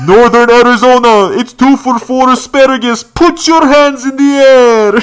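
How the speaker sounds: very high, loud crowd-hyping holler; pretend DJ announcer. DJ hyping shoppers at Sprouts, over-the-top high shout. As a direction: announce groceries like a festival DJ, stretching the ends. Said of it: "Imagine you're in Sprouts and you hear".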